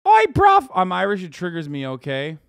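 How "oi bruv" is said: a high, loud British "Oi, bruv"; mock British. Streamer parodying British slang for chat, loud high mock British call. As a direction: bark out a cartoon British Oi bruv.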